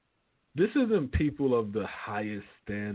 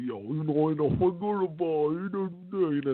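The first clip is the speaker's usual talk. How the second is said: mock New York Italian gangster accent, pitched up; mumbling Italian-American; 6 semitones higher than usual. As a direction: talk like you've got marbles in your mouth, Italian-American wiseguy. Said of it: "like they got fucking marbles in their mouth".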